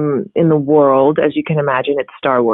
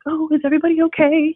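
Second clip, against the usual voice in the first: high, quick mock-fretful check-in; anxious people-pleaser; 11 semitones higher, faster than usual. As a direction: send up her own everyday worrying, pitched up and fast. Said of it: "I'm like".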